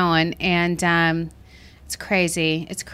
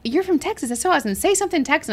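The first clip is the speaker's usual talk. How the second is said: higher-pitched mock enthusiasm; higher, playful quoted gushing; imitated eager request; 8 semitones higher, faster than usual. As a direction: imitate an impressed stranger asking for a party trick. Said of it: "people are like".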